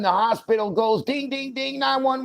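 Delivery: high sing-song hospital alarm ding; mock hospital alarm chime; alarm imitation. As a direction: mimic the alarm bells in a high voice.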